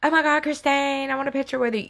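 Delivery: high-pitched gushing fan; imitating a breathless, over-the-top fangirl; mock fangirling. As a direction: squeal it like a starstruck fan. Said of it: "I'm not gonna fangirl like".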